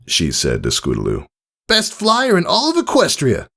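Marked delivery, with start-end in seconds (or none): 1.7-3.5 high, brash and friendly; cocky praise from a hero; bold tomboy mare voice. Acted. Rainbow Dash praising Scootaloo after the fight, brash and friendly.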